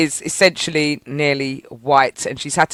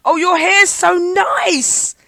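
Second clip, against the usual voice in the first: high, drawn-out mimicry of a gushing admirer; gushing woman; 13 semitones higher, much slower than usual. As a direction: coo it slowly in a put-on high voice. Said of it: "This woman was touching".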